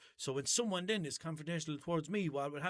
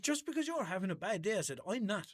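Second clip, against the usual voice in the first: indignant telling-off; 6 semitones higher than usual. Retold or imitated.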